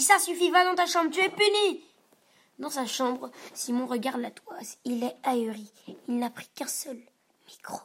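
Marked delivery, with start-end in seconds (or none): 0.0-1.9 higher, louder scolding; angry father. A narrator's reading. Father punishes rude Simon, angry and loud. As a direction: send him to his room, furious. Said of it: "papa s'énerve".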